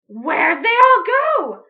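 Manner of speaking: slow, loud, high demand; witch shout. Acted. Witch Grinch shouting at a duck, loud and slow. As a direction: yell it across the yard. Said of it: "Grinch shouted to a duck".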